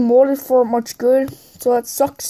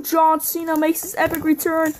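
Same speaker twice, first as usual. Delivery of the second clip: usual speaking voice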